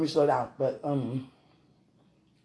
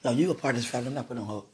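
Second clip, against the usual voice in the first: ordinary delivery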